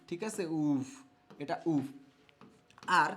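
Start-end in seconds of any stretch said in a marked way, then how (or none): 0.6-1.9 teacher sounding out "উফ" by itself; demonstrated pronunciation. Shown as a demonstration. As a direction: hold the vowel of "উফ", then close the lips lightly. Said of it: "সেটা কি হয় উচ্চারণ আমার?".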